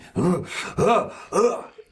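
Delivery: sharp heaving gasp; frenzied gasp. Acted. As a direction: gasp like a raging brute. Barbarian works into frenzy.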